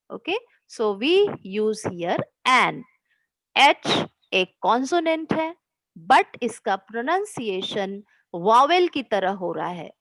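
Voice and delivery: ordinary talk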